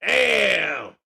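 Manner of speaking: usual speaking voice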